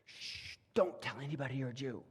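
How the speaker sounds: imitating a hushed, quick whisper warning. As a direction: shush them and quickly warn in a whisper. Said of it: "Mordecai's been telling Esther".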